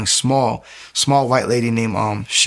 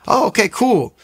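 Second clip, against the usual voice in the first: friendly stranger; 10 semitones higher than usual. Retold or imitated. Stranger reacts to the Bible.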